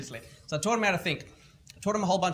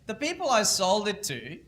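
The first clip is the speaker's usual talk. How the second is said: smug triumph; 7 semitones higher, 6 dB louder than usual. Retold or imitated. Kid tells his mentor he's CEO again, smug and triumphant. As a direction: savor the reveal like a victory lap. Kid's triumphant punchline, voiced.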